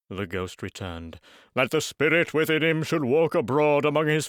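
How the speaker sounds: slow, heavy, doom-laden voice; mournful ghost. Acted. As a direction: lament it like a chained spirit.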